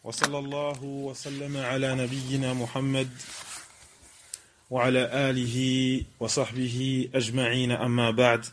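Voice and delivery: low, soft formal recitation; opening invocation. Acted. Teacher opening a religious lesson with praises, formal low recitation.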